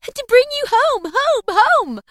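put-on very high, loud child's delight; overjoyed small sister; gleeful little girl. A narrator's reading. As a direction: squeal it joyfully, each "home" ringing out. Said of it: "bending down to laugh".

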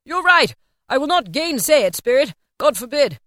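high, loud, fervent protest; impassioned Scrooge, voice lifted; crying out. A narrator's reading. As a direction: cry it out with feeling. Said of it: "cried Scrooge".

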